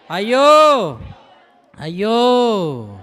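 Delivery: drawn-out lament, slow; mock wailing. Kings mourning the burning city, mock wail. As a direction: act out the kings' grief. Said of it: "ఏడ్చుచు".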